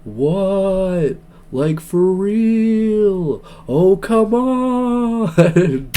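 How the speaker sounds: high, drawn-out, slow ghostly wail; very slow, high ghost moan. Shown as a demonstration. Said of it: "let me say that in my ghost voice".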